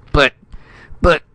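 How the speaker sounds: halting protest, broken by pauses; stuttering. A narrator's reading. Silenced ambassador trying to object, stuttering. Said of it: "Spradley stuttered".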